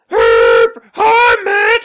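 very high, loud mock holler; shouted greeting, imitated. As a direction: screech it like someone calling from a van. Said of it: "I hear this voice say".

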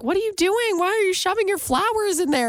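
high puzzled teacher; lightly voiced concerned teacher. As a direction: ask a kid what she's doing. Teacher asking, voiced higher.

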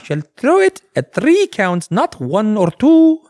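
high, slow, intoned decree; mock scripture. Host finishing the holy hand grenade quote in a mock-biblical voice. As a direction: chant it like solemn holy writ. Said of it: "continuing the Python quote".

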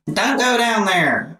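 high-pitched Southern warning; Southern accent. Shown as a demonstration. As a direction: younger self with a Southern twang. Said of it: "that's what I used to sound like".

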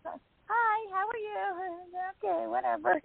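high, polite phone greeting; imitated awkward politeness. She greets the wife on FaceTime, imitated awkward high politeness. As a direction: mimic an over-polite hello, pitched high.

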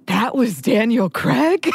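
gasping surprise. Retold or imitated. Mom at the credits, gasping in slow surprise. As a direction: imitate mom's stunned realization. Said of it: "audibly gasps".

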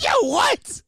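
high yelp breaking into laughter; retold shock, pitch way up; mock shocked disbelief. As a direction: crack up while acting stunned. Shocked reaction retold amid laughter.

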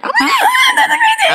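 laughing high. Real, not acted. Laughing outburst.

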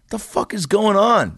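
incredulous. Retold or imitated. Baffled by Hulk getting up.

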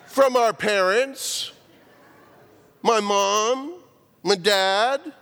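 put-on high, loud child voice; imitating a kid blurting it out; child answering the preacher, high and loud; kids' answer. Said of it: "they just kinda went".

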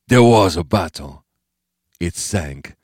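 put-on accented old man, falling away at the end; Bo Pan's voice. A narrator's reading. Bo Pan explaining to Steve, accented old-man voice.

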